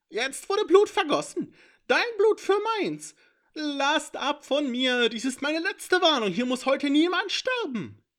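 high, loud, threatening creature voice; a cornered imp bargaining through threats; high tense warning. A narrator's reading. Cornered intruder warning the heroes off, high and loud.

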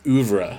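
"oeuvre" said OOV-ruh; French-derived "oeuvre" as OOV-ruh; looked-up pronunciation. Shown as a demonstration. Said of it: "According to the Pronunciation Academy".

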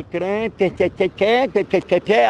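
mock stammer on repeated te; stuttering imitation. Rabbi answering an accused student, mock stammering.

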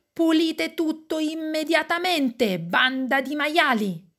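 high, angry parental shout; grido del papà. A narrator's reading. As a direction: bellow the order at the kids, pitch up. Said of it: "Allora si alza e grida". (urlo acuto di papà arrabbiato)

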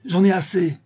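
high, fed-up outburst; crying out. A narrator's reading. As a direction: snap that you have had enough. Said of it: "cria-t-il". (cri excédé, aigu)

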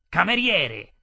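loud, sharp summons; calling the waiter. Acted. Angry customer summoning the waiter, loud call. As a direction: call out loudly for service. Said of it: "Finalmente mi chiamò".

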